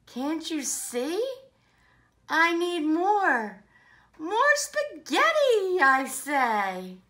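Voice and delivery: loud, punchy picture-book dialogue; animated story reading. Acted. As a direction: act out pleading and refusal, peaking high on the demand. Playmates argue in the story.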